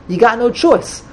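ordinary talk

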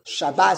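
"Shabbat" with open A and final T, sha-BAT. Shown as a demonstration. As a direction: stress the last syllable of "Shabbat", ending on T.

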